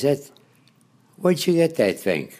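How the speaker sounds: put-on curious cabbie in a light Baltimore accent. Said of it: "I loved the Baltimore accent".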